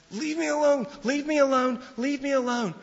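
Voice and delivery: high, loud, frantic repeated cry; screaming for him to get away; panicked scream. Retold or imitated. Daughter in the park screams at the stranger in panic.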